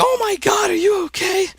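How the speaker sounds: very high, shrill-free squeal of a panicked mom; worried mom. Retold or imitated. Mom seeing her son's bloody leg, high panicked squeal. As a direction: squeal it like a frantic mother. Mom reacting to injury photo.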